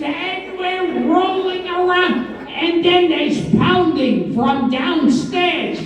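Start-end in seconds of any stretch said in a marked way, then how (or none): none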